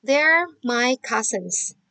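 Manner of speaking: very slow and distinct; a teacher showing children how to read the answer; slow model reading. Shown as a demonstration. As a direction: read it out word by word. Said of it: "Jadi bacanya".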